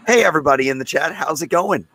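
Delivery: usual speaking voice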